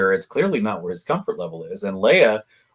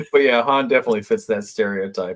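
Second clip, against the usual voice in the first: ordinary talk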